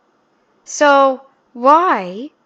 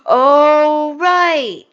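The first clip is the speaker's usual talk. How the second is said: drawn-out, higher-pitched fake epiphany; mock realization; 4 semitones higher, 4 dB louder than usual. Mocking her for forgetting the word yolk, feigned realization. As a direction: pretend it has only just dawned on you.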